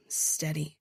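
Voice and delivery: ordinary delivery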